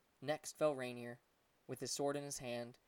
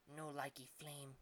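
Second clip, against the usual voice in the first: slow, much softer sulky little creature; a small monkey sulking with his face hidden; pouting monkey voice; 9 dB quieter, slower than usual. A narrator's reading. Pouting monkey refuses dragon.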